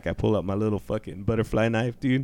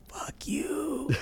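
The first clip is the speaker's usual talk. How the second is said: high whispered last curse; faint dying whisper; 11 dB quieter than usual. Retold or imitated. The dying squirrel whispering a last curse. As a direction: breathe it out like a last gasp.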